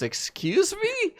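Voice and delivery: slow, high, offended "Excuse me?"; the Nez, offended by the assassin juice, slow high indignant. Acted. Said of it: "the Nez looks at you and goes".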